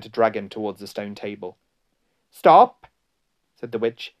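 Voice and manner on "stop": high, very loud witch's cry; sudden ringing order; commanding shout. A narrator's reading. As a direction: cut them off with a sharp shout. Witch commands her followers to halt.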